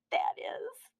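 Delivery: high whispered aside. A narrator's reading. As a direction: breathe it out as a whisper.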